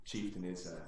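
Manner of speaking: normal talking voice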